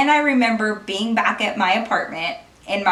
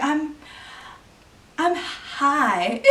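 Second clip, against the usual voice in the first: quieter, dopey, halting confession; dumb voice; 4 semitones higher, 5 dB quieter, much slower than usual. Retold or imitated. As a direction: mumble it dumb and stoned. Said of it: "in, like, the dumbest voice".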